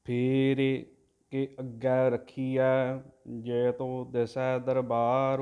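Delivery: drawn-out devotional chanting, word by word; slow santhiya recitation of scripture; slow Gurbani recitation. Shown as a demonstration. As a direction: chant each syllable slowly and evenly, like a granthi. Teacher chants the Gurbani line.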